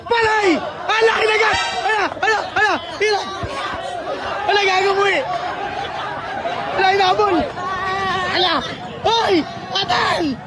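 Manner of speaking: high, urgent cries; worked-up, high-pitched exclamations; alarmed shouting. Real, not acted. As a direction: cry out in shock at a fight breaking out. Alarmed reaction to biting, fight.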